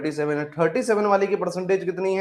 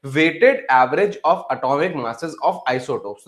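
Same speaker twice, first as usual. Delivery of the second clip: very slow, drawn-out reading; slow dictation; much slower than usual. Real, not acted. Teacher reading the English definition back to students, slow dictation. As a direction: spell out the definition word by word. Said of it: "क्या बोल रहा है?".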